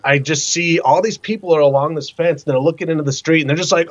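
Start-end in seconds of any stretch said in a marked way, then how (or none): none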